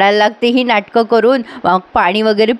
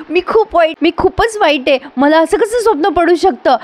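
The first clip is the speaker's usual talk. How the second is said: much higher, wailing fake remorse; mock self-blame; 7 semitones higher than usual. Daughter slaps herself in fake remorse, much higher wailing. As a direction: whine as if ashamed of yourself. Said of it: "तोंडात मारून घेते".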